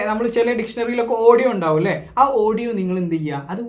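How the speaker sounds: ordinary talk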